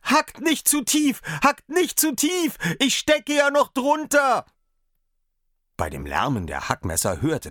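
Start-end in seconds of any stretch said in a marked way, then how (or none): 0.0-1.2 high, loud, slow cry, stress on "nicht"; desperate cry. A narrator's reading. Daumerling cries out to the butcher, pleading. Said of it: "schrie er aus Leibeskräften". 1.4-4.5 high, loud cry with "nicht" rising; desperate cry. A narrator's reading. Daumerling begs the butcher from under the meat. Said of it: "schrie er aus Leibeskräften".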